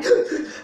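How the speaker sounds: sharp high gasp for air; startled gasp. Acted. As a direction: gasp like someone surfacing from water. Mimes drowning, gasping.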